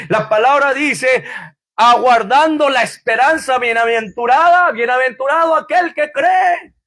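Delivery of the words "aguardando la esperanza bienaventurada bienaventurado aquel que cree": higher shouted blessings; preaching. Acted. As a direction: proclaim each blessing. Preaching beatitudes. (proclamando a gritos)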